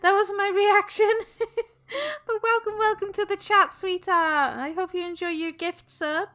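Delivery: high, loud, bubbly welcome to the chat; excited chat greeting. Real, not acted. Streamer breaks from the story to welcome chat excitedly. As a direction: greet new subscribers with a burst of glee.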